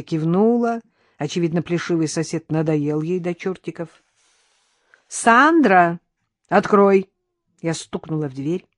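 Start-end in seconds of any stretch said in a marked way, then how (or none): 5.1-7.1 loud, higher call through a door. A narrator's reading. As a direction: call to someone behind a closed door. Calling through the closed door. (громко зовёт)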